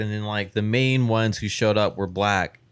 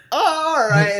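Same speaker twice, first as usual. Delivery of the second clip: high, loud embarrassed "Oh, all right"; awkward high squeak of surrender; sheepish retreat; 5 dB louder than usual. Retold or imitated. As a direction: backpedal in a high put-on voice. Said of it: "And I'm like".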